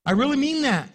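ordinary delivery

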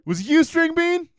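very high, loud, drawn-out taunt; mock arguing. Booth talking shit back at the heckler, mock yell. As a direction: holler it slowly in a hammed-up high voice. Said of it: "they just start talking shit".

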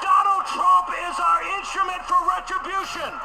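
rally the crowd to holy war; shouted like a fiery sermon; fervent shout. Real, not acted. Rallying crusade cry.

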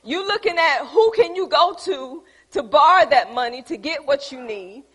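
normal talking voice

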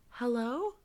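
a hint of a small child asking into the phone line; little girl's voice. Ghostly girl on the phone line, a mild little-girl hello. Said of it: "it's a little girl's voice going".

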